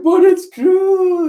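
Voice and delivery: high, loud, slow crying plea; tearful wail. Acted. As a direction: wail it through tears. Nami tearfully insists, starts crying.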